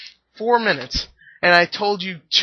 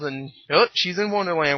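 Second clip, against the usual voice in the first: ordinary talk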